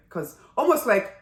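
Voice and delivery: usual speaking voice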